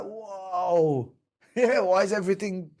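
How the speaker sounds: high, laughing mock panic at a shaking world; hammed-up laughing alarm, pitched high. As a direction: play the bewildered man whose life is suddenly out of control. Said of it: "they're like".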